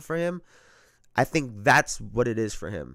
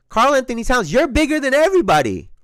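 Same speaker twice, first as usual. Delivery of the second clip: high, loud direct appeal; raised voice talking straight to the player; emphatic address; 11 semitones higher, 7 dB louder than usual. Real, not acted. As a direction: call him out by name.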